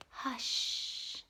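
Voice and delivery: whispered gentle hush; nicely. A narrator's reading. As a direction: soothe the baby in a whisper.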